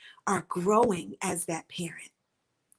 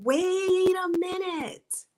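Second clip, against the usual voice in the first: body crying out; 9 semitones higher, 3 dB louder, much slower than usual. Retold or imitated. Body screaming wait a minute.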